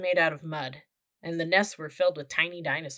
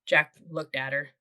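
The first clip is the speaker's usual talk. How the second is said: ordinary delivery, nothing marked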